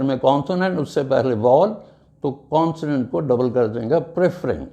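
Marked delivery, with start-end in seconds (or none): none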